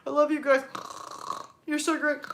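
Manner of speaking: mock dozing on stream; pretend snoring sleep-talk. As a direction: mumble between fake snores. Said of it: "snore on the live stream".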